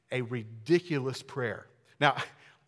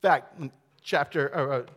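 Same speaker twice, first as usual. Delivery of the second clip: usual speaking voice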